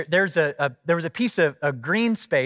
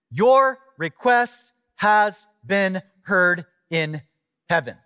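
slow, word by word proclamation; emphatic scripture reading; 4 semitones higher, 4 dB louder, much slower than usual. Real, not acted. Preacher reads angel's answer to Daniel, slowly and emphatically. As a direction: land every word separately, with weight.

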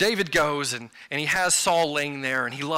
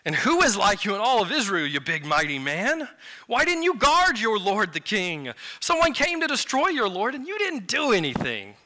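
high, sing-song mocking challenge; acted taunt, pitch swooping up; 5 semitones higher than usual. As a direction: goad the guard with exaggerated scorn. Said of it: "David is taunting Abner here".

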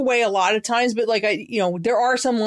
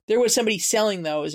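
ordinary delivery

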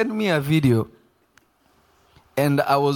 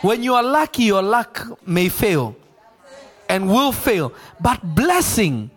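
raised pitch, emphatic pulpit delivery; impassioned preaching; 5 semitones higher than usual. Real, not acted. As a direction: drive each phrase home to the congregation. Preaches at full force.

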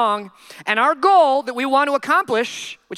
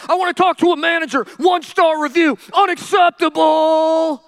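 louder and pushier, then stretch out unacceptable; mock customer yelling; 4 semitones higher, 5 dB louder than usual. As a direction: ham up an entitled customer demanding the manager.

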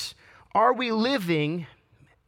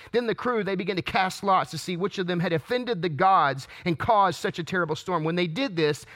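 ordinary delivery

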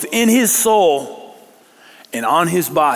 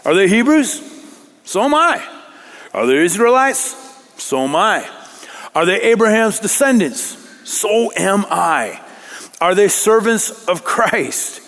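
raised, ringing questions with firm answers; declaimed scripture; 6 semitones higher than usual. Acted. Preacher reading Paul's boast against super apostles, declaimed scripture. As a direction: challenge the rivals, pitching each question high.

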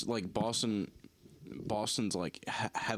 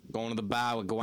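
exaggerated Boston accent; 3 semitones higher, 3 dB louder than usual. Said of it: "a really bad, very exaggerated".

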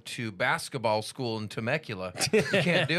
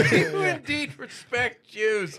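higher, drawn-out imitation through laughter; mock quoted question; 10 semitones higher, slower than usual. Friend teasing comedian about his backswing question, high mock imitation. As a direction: echo the question slowly and high, amused.

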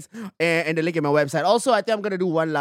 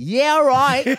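higher, louder Australian "yeah, right"; mock Australian accent; 6 semitones higher, 4 dB louder than usual. Teased about Melbourne, he answers in mock Australian accent. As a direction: lay on an Aussie twang. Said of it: "speaking exclusively in the accent".

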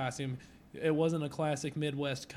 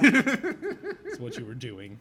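laughing; 9 dB louder, much slower than usual. Real, not acted. Teasing through laughter.

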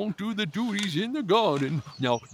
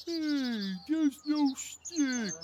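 indignant surprise; 9 semitones higher, 4 dB quieter, much slower than usual. Acted. Dog finds no stick.

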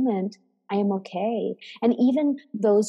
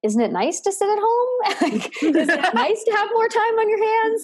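much higher, giggling through "Isn't it nice to sit at home"; giggling Eckhart Tolle; 13 semitones higher than usual. Retold or imitated. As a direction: tease gently while giggling, voice pitched way up. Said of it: "he sort of was giggling and saying".

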